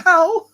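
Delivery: high, loud "ow"; sudden comic cry of pain; mock pain yelp. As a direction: yelp as if your hand just got bitten. Acts out losing a hand.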